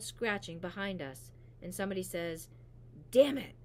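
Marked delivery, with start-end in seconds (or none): 3.1-3.5 loud, higher frustrated curse; a loud frustrated outburst; frustrated shout. Acted. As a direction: blurt it out in frustration. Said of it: "in a frustrated tone".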